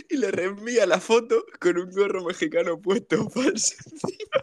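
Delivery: laughing through the punchline; words breaking up with laughter. Real, not acted. Telling a friend the punchline while cracking up.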